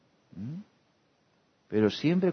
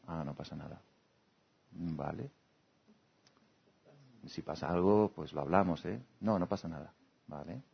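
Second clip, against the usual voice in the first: much softer and lower, patient; soft reassuring; 6 semitones lower, 8 dB quieter than usual. Acted. Friend calmly accepting the answer, soft and patient. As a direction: leave space, gently. Gentle calm reply.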